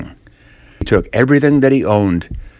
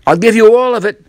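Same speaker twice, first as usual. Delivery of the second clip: put-on high, loud boyish plea; desperate Ben; 11 semitones higher, 4 dB louder, faster than usual. Ben offering Tom the whole apple, high desperate plea. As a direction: blurt out the last offer.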